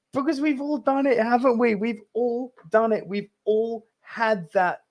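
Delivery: high, choked voice through real tears; tearing up. Real, not acted. Host tells viewers about lost childhood friends, choked up. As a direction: hold back tears that push the pitch up. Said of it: "I'm actually tearing up now".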